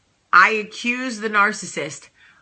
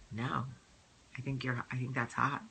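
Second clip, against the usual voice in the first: low, soft, offhand husband reply; husband's low voice; 8 semitones lower, 13 dB quieter than usual. Retold or imitated. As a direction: voice dropped lower and much softer for the husband. Voicing husband Michael's reply.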